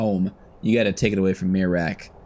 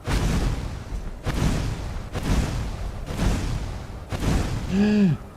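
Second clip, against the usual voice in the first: sharp gasp; 11 semitones higher, 3 dB quieter than usual. Real, not acted. Sudden fight moment.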